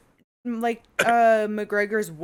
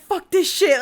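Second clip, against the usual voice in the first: higher, louder imagined blow-up; mock fed-up outburst; 9 semitones higher, 8 dB louder than usual. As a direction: act out someone losing patience, half laughing. Imagined fed-up coach blowing up.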